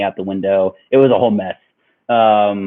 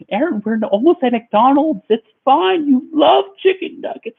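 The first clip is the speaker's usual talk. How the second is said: mock coaxing girlfriend. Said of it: "And she's like".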